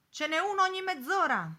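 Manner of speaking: sailor calling out. A narrator's reading. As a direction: call out information from a departing boat. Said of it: "gridò un marinaio".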